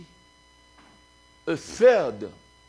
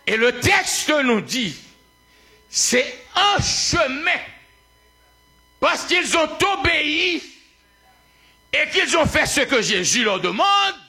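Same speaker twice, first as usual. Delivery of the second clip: high, emphatic sermon delivery; full-force preaching; 11 semitones higher, 5 dB louder than usual. Real, not acted. Preacher proclaiming the servants obeyed Jesus, full-force preaching. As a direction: hold each phrase high and proclaim it.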